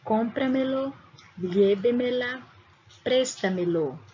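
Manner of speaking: "cómpramelo", "llévemela", "préstamelo" said in Spanish with stress on the first syllable; Spanish example words. Shown as a demonstration. Teacher reads the example words to the class, in Spanish. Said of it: "Observe nos exemplos as palavras".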